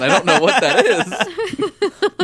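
lips on fire, laughing through it; mouth burning from spicy food. Real, not acted. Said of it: "my insides are burning".

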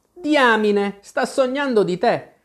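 high-pitched gleeful exclamation; triumphant exclamation. A narrator's reading. Tweedledee reveals the dream to Alice, triumphant exclamation. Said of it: "esclamò Tweedledee e batté le mani trionfante".

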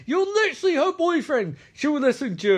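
high-pitched acted protest; mock exasperation. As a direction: protest in exaggerated disbelief. Acted argument, Riker exasperated.

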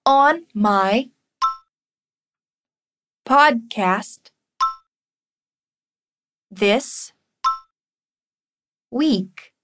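slow, word by word; slow teacher modelling; chunked drill. Shown as a demonstration. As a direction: model each piece for repeating. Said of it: "Vamos praticar essa pronúncia".

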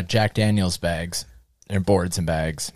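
ordinary talk

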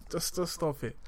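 usual speaking voice

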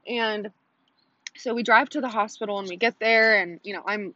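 ordinary talk

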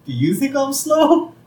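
high-pitched, incredulous challenge; affronted question. Retold or imitated. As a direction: ask it high, as if insulted. Homer challenges silent family.

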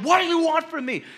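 high, loud cry of frustration; shout. Retold or imitated. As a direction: yell it up, exasperated. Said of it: "But I just shouted".

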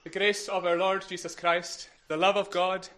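loud, measured blessing in short phrases; benediction. Real, not acted. Minister blessing the congregation at the close, formal benediction. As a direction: pronounce the blessing out over the congregation.

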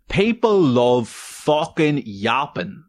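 slow, drawn-out deadpan read; reading own note. Acted. Podcaster reads his own note aloud, slow deadpan. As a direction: read the note out slowly like a verdict. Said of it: "one of the notes that I have here is".

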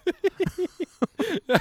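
high burst of laughter; loud high laugh. Real, not acted. Laughing at being fired joke.

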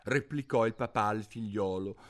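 ordinary delivery

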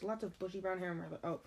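usual speaking voice

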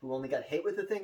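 normal talking voice

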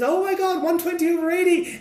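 high, mock-alarmed gasp. The heroine reading his blood pressure, mock alarm. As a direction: gush it high like a panic. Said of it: "and she says".

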